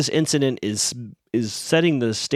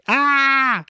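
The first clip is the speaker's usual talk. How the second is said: pained yelp; 11 semitones higher than usual. Retold or imitated. Little boy cries out after falling off the bed. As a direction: yelp like a kid who just hit his chin. Child falls and cuts chin.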